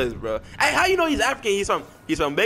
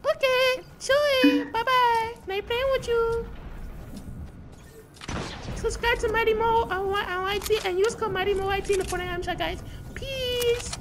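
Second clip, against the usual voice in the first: much higher, thin put-on squeak; squeaky high voice. Signing off to the lobby in a much higher squeaky put-on voice. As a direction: chirp it way up high like a toy. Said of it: "using a voice changer".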